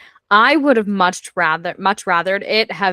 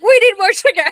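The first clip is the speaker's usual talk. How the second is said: much higher and louder, hammed-up; mock squeaky excuse; 13 semitones higher, 6 dB louder, faster than usual. S1 repeats Tristan's excuse.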